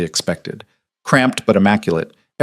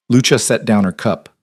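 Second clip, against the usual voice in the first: plain reading voice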